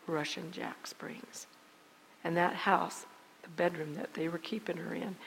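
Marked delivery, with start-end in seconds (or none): 0.1-1.5 slow hushed whisper of the name. Acted. Preacher telling the congregation where she was, whispered slowly. Dramatic reveal in testimony. 3.4-5.2 hushed whisper. Acted. Preacher describing the hidden bedroom in a whisper. Dramatic reveal in testimony.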